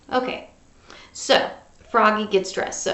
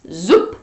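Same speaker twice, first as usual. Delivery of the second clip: lower, louder punchy zoop; zoop sound effect; 4 semitones lower, 5 dB louder than usual. A narrator's reading.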